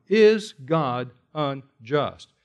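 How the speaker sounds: each word laid down slowly; slow weighty question. Acted. Preacher posing the study's central question, slow and weighty. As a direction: let the question hang heavy. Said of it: "exactly the question".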